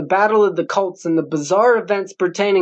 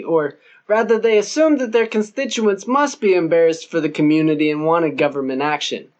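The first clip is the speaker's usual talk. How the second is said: usual speaking voice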